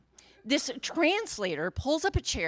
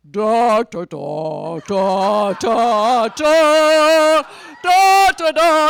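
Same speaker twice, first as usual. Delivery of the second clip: loud, booming da da da; loud mock Morse reply; radio signal reply; 16 dB louder, much slower than usual. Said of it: "this voice comes over the radio".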